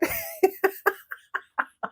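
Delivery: high laugh sliding down; falling laugh. Real, not acted. Laughing at own story.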